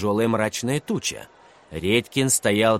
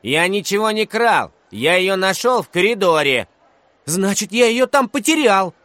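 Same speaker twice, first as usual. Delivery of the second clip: put-on high, heated child voices; raised-pitch playground quarrel; squabbling schoolboys; 8 semitones higher, 5 dB louder than usual. A narrator's reading. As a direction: argue back like an indignant kid. Two boys quarrel over a pen.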